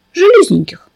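put-on high, thin little girl's word; tiny girl voice. A narrator's reading. Fenka asking the narrator for nails, thin high voice.

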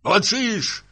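high-pitched, exclaimed accusation; angry cry. Acted. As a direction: cry out at her in anger. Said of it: "закричал супруг".

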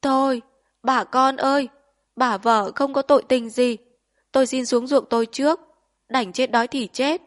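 loud, high plea through held-back tears; choked-back sobbing. A narrator's reading. Husband pleading with fellow villagers, choking back tears. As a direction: force the words out while fighting sobs. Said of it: "cố nhịn khóc để nấc".

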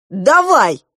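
loud, high, fired-up yell; hot-headed shout. A narrator's reading. Klop accepting a fight over a girl, hot-headed shout. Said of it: "запальчиво крикнул Клоп".

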